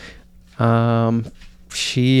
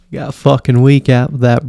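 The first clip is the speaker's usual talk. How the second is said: ordinary talk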